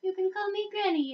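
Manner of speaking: kindly granny. Acted. Granny greeting newcomers to her community, in high granny voice. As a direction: welcome them as a sweet little granny. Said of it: "A grandma".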